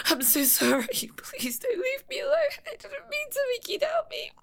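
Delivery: high, breathy begging whisper; tearful whispered plea. Acted. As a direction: plead in a choked whisper.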